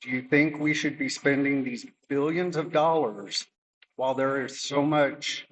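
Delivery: ordinary talk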